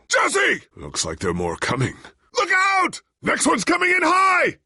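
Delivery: high, loud calls of alarm; urgent warning shout. Acted. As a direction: warn Jesse of incoming attack.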